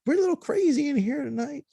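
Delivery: high, bubbly cashier voice; high chirpy apology from the young cashier; goofy young cashier. Retold or imitated. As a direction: play the silly girl at the window. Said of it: "She was like".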